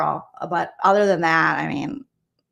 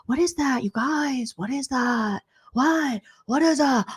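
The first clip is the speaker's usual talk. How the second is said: higher, hammed-up fake confusion; mock playing dumb; 4 semitones higher than usual. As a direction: repeat it like you've never heard the word. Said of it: "And he was like".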